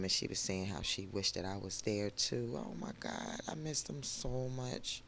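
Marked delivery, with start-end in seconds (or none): 2.6-5.0 very soft, emotional near-tears voice; hushed, holding back tears; about to cry. Real, not acted. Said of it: "I'm about to start crying".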